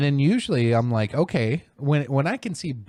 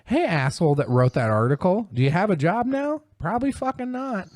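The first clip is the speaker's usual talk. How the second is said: high, jeering taunt at the article writer; mock taunting; 9 semitones higher than usual. Taunting the writer of the article, high and jeering. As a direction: needle him in a high mocking voice. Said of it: "I'm like".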